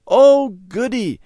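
high, loud, slow squeal of joy; excited cry. A narrator's reading. Girl hearing the picnic is saved, joyful squeal. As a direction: let the joy burst out. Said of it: "Debbie cried, dancing up and down".

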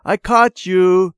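put-on loud, high, slow child's triumphant shout; playful shout. A narrator's reading. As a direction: yell it as you catch her. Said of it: "he shouted".

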